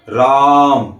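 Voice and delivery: राम with its आ stretched well past a normal long vowel; teacher showing pluta length on राम; prolonged vowel. Shown as a demonstration. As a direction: hold the आ in राम. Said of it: "आ का उच्चारण अधिक समय तक हुआ".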